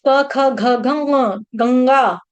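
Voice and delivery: गंगा with a velar ng; ङ as the ng inside गंगा; nasal sound demo. Shown as a demonstration. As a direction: recite the consonant row slowly up to ङ, then गंगा. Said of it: "उसका उच्चारण गंगा वाला ना".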